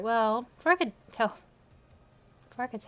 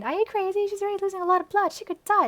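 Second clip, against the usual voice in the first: panicked; 10 semitones higher, 4 dB louder, faster than usual. Acted. Panicked character lines in story.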